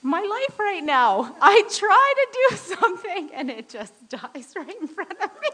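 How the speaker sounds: high, loud laughing through a prayer of despair; prayer broken by laughter. Retold or imitated. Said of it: "and I said".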